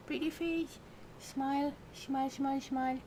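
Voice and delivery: high, soft cooing. Real, not acted. Cooing at her pet to smile for the camera, high baby talk.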